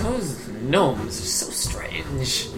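slow, hushed muttering; breath-only in-character aside, drawn out; whispered aside. Acted. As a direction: whisper it slowly to yourself. Character's aside about the gnomes.

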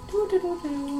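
soft, high sing-song doo-doo; mock-casual little tune, softly; innocent humming. Player pretending they aren't there, innocent doo-doo.

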